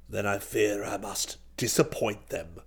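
low, quiet aristocrat; declining old duke. A narrator's reading. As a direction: decline very softly, deep in the voice.

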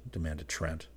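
plain reading voice

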